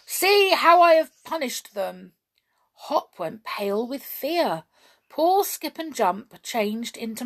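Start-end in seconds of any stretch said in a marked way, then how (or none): 0.1-2.1 gloating rage. Acted. Goblin still raging at Hop.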